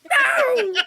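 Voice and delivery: very high, loud imitation of a scared player; hammed-up panicked scream; mock screaming. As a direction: shriek it like a jump scare in the next room. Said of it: "what I hear from the other room".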